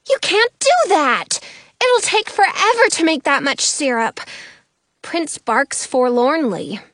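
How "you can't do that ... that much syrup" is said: upset protest. A narrator's reading. Goldie protesting.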